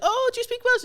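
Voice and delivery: high, loud, eager questioner; someone at uni. Retold or imitated. Student quoting someone at uni, high-pitched mock eagerness. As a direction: pitch it up as an over-keen stranger. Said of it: "someone would see that as Welsh and be like".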